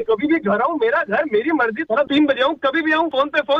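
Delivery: angry rant. Real, not acted. Husband yelling at his wife on a call, furious. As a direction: yell abuse down the phone.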